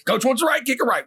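high, urgent coaching yell; hammed-up sideline shouting; fired-up coach. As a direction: imitate a coach hollering orders at his kicker. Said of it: "he started getting fired up".

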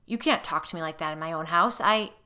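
loud furious protest cut off; angry outburst. A narrator's reading. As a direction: raise your voice, offended in your own house. Husband angry at guest.

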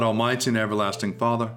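ordinary talk, nothing marked

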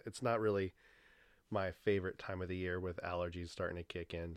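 ordinary talk, nothing marked

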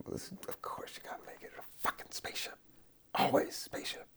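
fast, whispered aside to himself. Real, not acted. Podcaster whispers an aside about the doodle, fast whisper. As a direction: hiss it like a secret, quickly.

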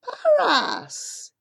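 surprised greeting. A narrator's reading. Mahout startled to see Papa, rising surprise. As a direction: recognize an old friend.

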